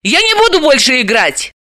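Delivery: high, indignant refusal; put-out heroine. A narrator's reading. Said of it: "возмутилась Далила".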